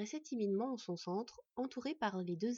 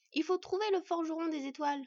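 put-on high, louder excited child voice; eager child; 8 semitones higher, 5 dB louder than usual. Boy to the sleepy wolf, high and eager. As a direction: urge them on with childlike eagerness.

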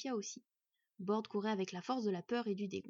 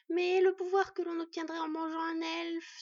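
protesting spiders; 8 semitones higher, 7 dB louder than usual. A narrator's reading. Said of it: "se récrièrent les deux arachnides".

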